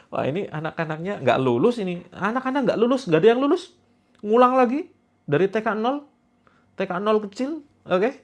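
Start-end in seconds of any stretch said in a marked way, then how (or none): 2.1-8.1 teasing teacher. Pretend. Teasing viewers as failed pupils.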